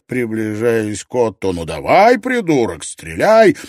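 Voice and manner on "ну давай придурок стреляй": loud, angry dare; taunting shout. A narrator's reading. Ott taunts the armed medic, angry shout. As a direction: goad him at full voice.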